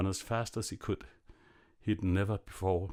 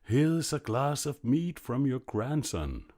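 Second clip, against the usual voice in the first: higher, slow, bawled words; 7 semitones higher, 3 dB louder, slower than usual. A narrator's reading. Landlord bawling at the dead grandmother, slow loud call. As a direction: bawl it slowly so a deaf ear hears. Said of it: "cried the landlord as loud as he could".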